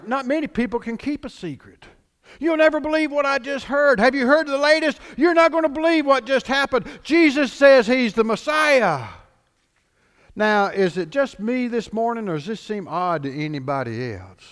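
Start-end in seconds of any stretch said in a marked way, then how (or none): 2.4-9.1 excited gossip. Acted. Gossiping townsfolk voiced.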